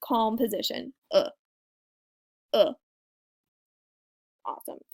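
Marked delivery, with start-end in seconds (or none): 1.1-2.7 "Uh" short and relaxed, mouth neutral; a quick neutral "uh". Shown as a demonstration. Teacher modelling the French E, short and neutral. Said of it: "So just very neutral".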